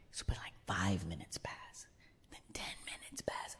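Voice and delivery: barely audible whispered storytelling; hushed suspense. Acted. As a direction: whisper the minutes ticking by. Suspense as he waits.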